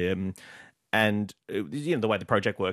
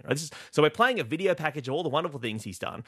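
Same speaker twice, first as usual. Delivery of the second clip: ordinary talk, nothing marked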